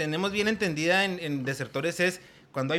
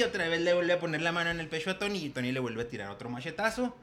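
usual speaking voice

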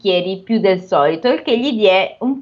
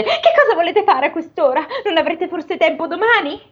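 ogre's wife voice; 7 semitones higher than usual. Acted. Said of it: "la moglie gli disse".

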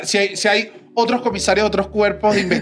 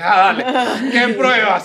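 scoffing; 4 semitones higher, slower than usual. Retold or imitated. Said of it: "Yo me, me reí".

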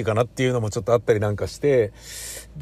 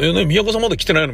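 imagined co-host asking; 7 semitones higher, faster than usual. Retold or imitated. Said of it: "みたいなことになっちゃうから".

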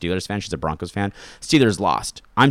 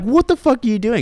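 high, bewildered what-the-hell; baffled outburst; 14 semitones higher, 4 dB louder than usual. Retold or imitated. Boyfriend stunned when girlfriend fakes being hurt, high bewildered outburst. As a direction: caught off guard, a touch loud. Boyfriend shocked by fake accusation.

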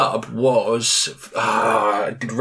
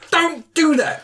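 much higher, yelping at the screen; high-pitched yelp; slower than usual. Retold or imitated. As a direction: squeal it at the TV. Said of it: "I'm like".

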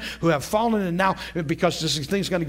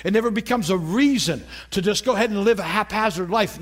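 ordinary delivery

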